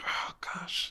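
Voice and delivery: whispered under breath. Retold or imitated. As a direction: hush it, don't make a scene. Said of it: "I was like".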